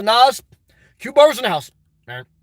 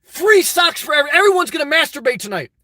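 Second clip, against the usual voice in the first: higher-pitched mock stump speech; rousing campaign pitch; 5 semitones higher than usual. Shown as a demonstration. Streamer showing how to grab people's attention, rousing. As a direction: pitch it to fire up a crowd. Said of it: "You gotta get up there. You gotta say".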